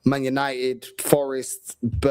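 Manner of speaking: ordinary talk, nothing marked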